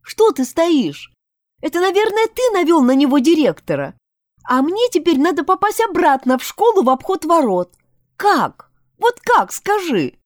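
high-pitched, exasperated complaint; worked-up, acted indignation; agitated reproach. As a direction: scold a friend, pitch jumping high. Heroine berates Ромашка over missing way in.

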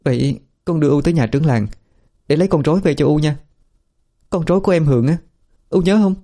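put-on high, quick gentle coaxing like to a child. A narrator's reading. Daughter coaxes her confused mother home, gentle and high. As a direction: soothe the mother into coming home. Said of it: "vừa dỗ dành".

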